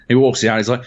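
ordinary delivery, nothing marked

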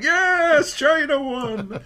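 very high mock cheer; hammed-up patriotic whoop; excited cheer. Acted cheer back to the foreign player.

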